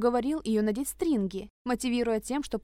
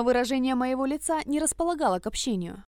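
usual speaking voice

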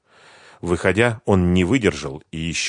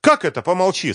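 rising indignant retort, higher; getting worked up; 14 semitones higher, 6 dB louder than usual. A narrator's reading. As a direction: start to boil over. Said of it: "снова начала заводиться Лиза".

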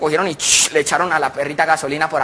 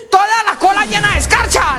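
outraged shout; 4 dB louder than usual. Retold or imitated. Husband outraged about wife's glitter.

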